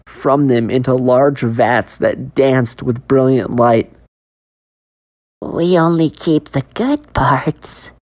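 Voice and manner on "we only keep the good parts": slow, sinister gloat; gloating vendor. Acted. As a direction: savour each word. Man gloating.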